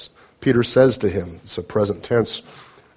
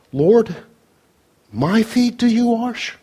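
Peter's shocked question, "my" pushed up high; emphatic; 11 semitones higher, slower than usual. Retold or imitated. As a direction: stress on my, high and slow. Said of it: "It's emphatic in the Greek".

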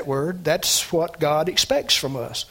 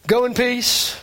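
"Go in peace" higher and louder, as a warm send-off; heartfelt blessing; 6 semitones higher, 5 dB louder than usual. Shown as a demonstration. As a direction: bless her with "Go in peace", lifting "Go". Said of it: "My thoughts".

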